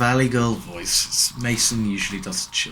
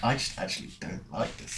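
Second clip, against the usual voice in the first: whispered aside; 11 dB quieter, faster than usual. Retold or imitated. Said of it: "you're like".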